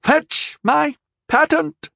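put-on very high, loud old man's voice; panicked old knight, high and loud; insisting old knight. A narrator's reading. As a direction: protest it urgently. Old knight calling servant.

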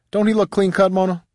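very high-pitched admiring character voice; teasing older woman. A narrator's reading. Shirley showing the newcomer off to Mona, high and teasing.

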